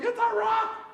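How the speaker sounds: put-on excited child. Father imitating son at gem mine, high amazed cry. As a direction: shout it like a boy who found treasure. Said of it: "he's just like".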